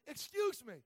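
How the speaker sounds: loud, high ranting complaint; imagined angry rant. Retold or imitated. Preacher acting out what he wanted to tell the cashier, loud mock rant. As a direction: let the cashier have it, half for laughs. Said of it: "what I wanted to say is".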